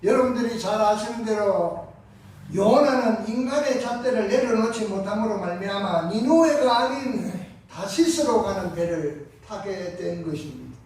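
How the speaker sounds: ordinary delivery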